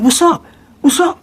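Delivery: high, rising confrontational what's up; mock challenge. Guys in the cell challenging, high mock voice. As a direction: square up at the door.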